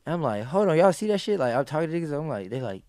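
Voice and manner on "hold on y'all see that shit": high, louder urgent question to the crew; alarmed call. Retold or imitated. Asking friends in the van, urgent alarmed call. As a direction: grab their attention, spooked.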